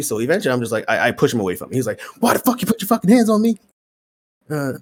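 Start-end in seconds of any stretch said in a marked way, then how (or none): 2.2-3.6 high, quick, mock-indignant demand; retold outrage. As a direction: imitate a man flying off the handle. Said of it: "he starts going fucking crazy".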